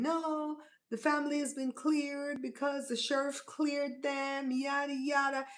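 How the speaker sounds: high, drawn-out mocking imitation. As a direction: stretch it out in a high, dismissive singsong. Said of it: "who keeps saying".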